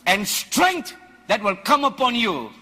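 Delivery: loud, high, laughing fire-and-brimstone preaching; preaching at full force. Acted. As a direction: shout it out to the congregation, laughing with zeal.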